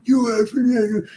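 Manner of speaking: high, slow furious outburst at another driver; road-rage curse at a driver; angry road rage. Retold or imitated. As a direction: snarl it like a young hothead cut off in traffic. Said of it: "twenty-three-year-old Alexander will be like".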